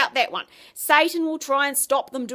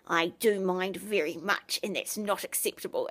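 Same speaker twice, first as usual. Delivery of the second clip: mean little voice; 6 semitones lower, 7 dB quieter than usual. Retold or imitated. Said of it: "this mean little voice".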